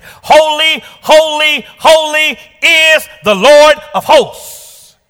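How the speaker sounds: high, slow ringing cry of holy; soaring preacher's shout. Acted. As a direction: proclaim it with full force. Preacher cries angels' song.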